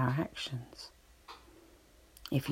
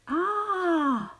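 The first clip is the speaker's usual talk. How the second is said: high drawn-out "ah" echoing back; long, high echo of a scream; 13 semitones higher than usual. A narrator's reading. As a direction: send the cry back like a mountain echo. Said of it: "he hears the voice repeating".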